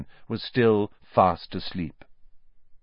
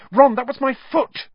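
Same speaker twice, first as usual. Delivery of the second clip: indignant; 7 dB louder than usual. A narrator's reading. Hermione stepped on in dark.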